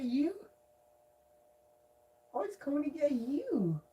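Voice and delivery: high, teasing pet-talk; playful baby talk. Pretend. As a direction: coo a mock threat at the cat. Playing with cat, high voice.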